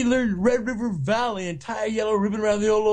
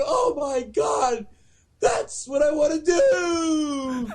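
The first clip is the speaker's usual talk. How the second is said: awestruck; 9 semitones higher, much slower than usual. Retold or imitated. Awed kid in the auditorium.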